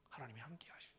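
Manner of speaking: breathy hushed prayer; whispered prayer. Retold or imitated. Mother whispering a prayer over her child.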